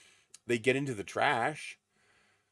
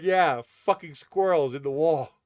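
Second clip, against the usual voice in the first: loud, slow gruff dad voice; a touch of the irritated father, louder and slower; gruff dad quote; 7 semitones higher, 11 dB louder, slower than usual. Retold or imitated. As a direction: bark it like the annoyed dad. Dad's angry retort about squirrels.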